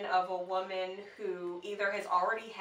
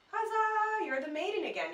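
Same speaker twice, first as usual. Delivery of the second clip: celebratory high-pitched huzzah; playful celebration; 12 semitones higher than usual. Pretend. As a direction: hail the rebirth in a high voice. Celebrating the cycle's rebirth.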